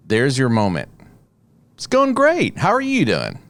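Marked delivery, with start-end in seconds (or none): 1.7-3.4 bright, much higher, upbeat greeting; forced cheerful hello; cheerful reply. Retold or imitated. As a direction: sell it like a great day.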